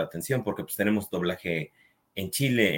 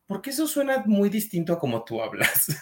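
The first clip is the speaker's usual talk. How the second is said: imitando al novio; 10 semitones higher than usual. Retold or imitated. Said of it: "porque dicen".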